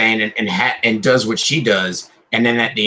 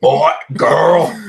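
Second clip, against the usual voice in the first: Kratos voice; 6 semitones higher, 4 dB louder than usual. Retold or imitated. Mock Kratos commands.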